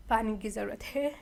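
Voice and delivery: rough, strained throat; a sore throat rasp; hoarse voice. Real, not acted. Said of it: "गला को कुछ हो गया है।".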